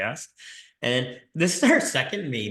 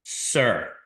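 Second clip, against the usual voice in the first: sassy sir. Retold or imitated. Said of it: "it's a S word".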